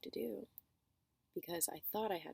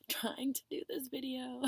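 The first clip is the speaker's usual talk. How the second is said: laughing; 7 semitones higher, 4 dB louder than usual. Real, not acted. Laughing, whispered.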